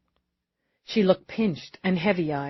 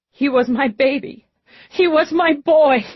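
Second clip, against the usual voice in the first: high, anguished grieving mother; tearful outcry; 8 semitones higher, 6 dB louder than usual. A narrator's reading. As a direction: cry out in grief, building louder. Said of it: "Tears gushed now".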